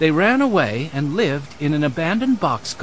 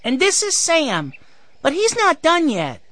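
put-on high, eager six-year-old boy; excited small boy's voice; excited cry; 13 semitones higher than usual. A narrator's reading. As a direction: pipe up high like a proud little brother. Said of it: "he cried".

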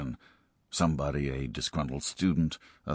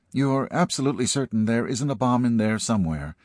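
higher, worried woman's voice; anxious principal voice; 6 semitones higher, 4 dB louder than usual. Acted. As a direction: press him, uneasy and doubtful.

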